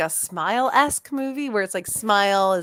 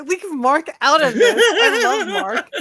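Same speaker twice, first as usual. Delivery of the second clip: laughing plea; 7 semitones higher, 6 dB louder, slower than usual. Real, not acted. Joking about Mark.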